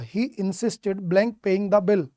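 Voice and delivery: very slow, each word spaced out; slow dictation. Shown as a demonstration. Teacher slowly reading a fill-in-the-blank to students. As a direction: spell out the blank slowly for the class. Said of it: "क्या कहोगे?".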